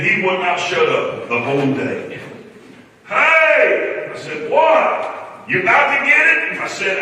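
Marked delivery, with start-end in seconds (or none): 3.1-4.1 high, loud call from above; imitated impatient boss yelling; yelling Hey. Johnny yelling at him under house. 4.5-6.6 high, loud impatient calling; imitated nagging boss; impatient yell. Johnny yelling at him under house.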